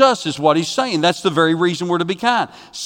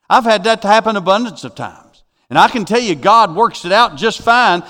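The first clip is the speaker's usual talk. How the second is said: higher-pitched, insistent sermon testimony; forceful preaching; 5 semitones higher, 4 dB louder than usual. Real, not acted. Preacher assuring believers God works it out, forceful and insistent. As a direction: exhort them with rolling conviction.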